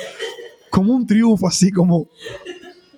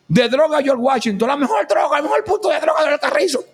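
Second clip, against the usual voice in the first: mock commercial announcer; 6 semitones higher than usual. Mock drug-spot ad.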